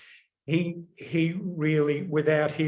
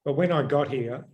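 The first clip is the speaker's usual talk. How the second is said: ordinary talk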